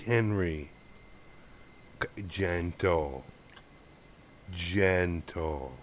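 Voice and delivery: tender pet talk for the camera; dog ASMR. Real, not acted. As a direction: coax the dog gently, half whispering. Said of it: "the Henry A S M R".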